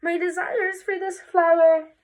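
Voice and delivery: high, theatrical pining voice; craving wife. Acted. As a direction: pine dramatically for the thing desired. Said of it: "And she's just like".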